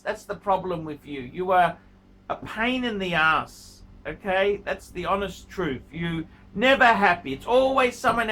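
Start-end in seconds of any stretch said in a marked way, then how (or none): none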